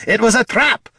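high, loud exasperated retort; snapped. A narrator's reading. Dwarf telling bard it was a trap, snapped. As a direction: snap it at him angrily. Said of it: "snapped Gorm".

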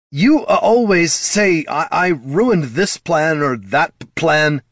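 high, slow, stuttering complaint; aggrieved outburst tripping over words; upset stammering. A narrator's reading. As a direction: protest hotly, stumbling as you go. Son stammers angry complaint at father.

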